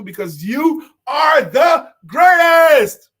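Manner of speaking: high, loud, slow shout; hype shout. Acted. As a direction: lift them up with a big cheer. Hyping up the audience.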